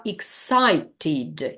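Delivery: EXCITED drawn out slowly, -ED as its own syllable; slow teacher's demo of EXCITED, ending fully voiced; clear -ed ending. Shown as a demonstration. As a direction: sound out EXCITED with a full "tid" at the end. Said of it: "Here we pronounce this E D".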